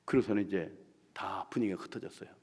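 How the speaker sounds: usual speaking voice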